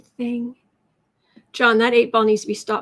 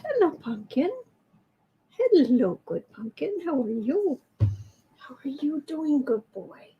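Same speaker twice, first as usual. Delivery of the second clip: cooing to the cat; 2 semitones higher than usual. Real, not acted. Talking to the cat Pumpkin in gentle pet talk. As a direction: coo over a sweet kitty.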